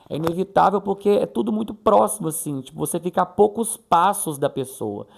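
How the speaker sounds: ordinary talk, nothing marked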